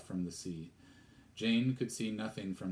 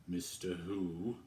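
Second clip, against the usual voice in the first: frowning vicar; slower than usual. Acted. Frowning vicar asks back.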